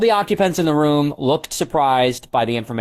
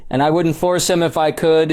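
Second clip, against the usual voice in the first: firm, higher-pitched stern reply; 5 semitones higher, 3 dB louder than usual. A narrator's reading. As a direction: lay it down sternly. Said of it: "he said sternly".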